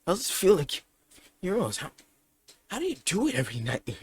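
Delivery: high-pitched starstruck gushing; excited fanboying. Acted. Izuku meeting his idol Stain the hero killer, excited fanboying. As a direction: gush questions like a thrilled fan. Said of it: "Izuku starts fanboying about it".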